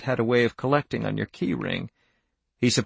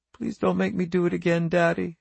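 put-on higher, pleading little girl's voice; acted tearful plea, pitched up; weeping; 6 semitones higher than usual. A narrator's reading. Daughter begging her father not to, weeping. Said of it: "she wept".

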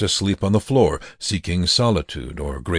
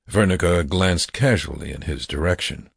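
usual speaking voice

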